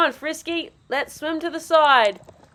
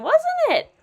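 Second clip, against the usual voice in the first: ordinary delivery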